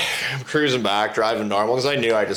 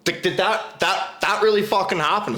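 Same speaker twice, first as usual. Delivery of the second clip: higher, stammering disbelief; stunned disbelief; 7 semitones higher than usual. Retold or imitated. Friends looking at each other after the Ferrari passes, stunned disbelief. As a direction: stumble over it, incredulous and pitched up. Stunned reaction acted in story.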